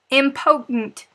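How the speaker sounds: Southern accent, Impotent with no r and the t swallowed. Real, not acted. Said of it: "picking on me about my accent".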